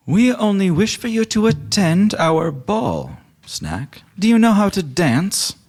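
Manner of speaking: sweet, courtly invitation; Carol the lich. Acted. Carol inviting Snack to the ball, high refined. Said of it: "Carol says".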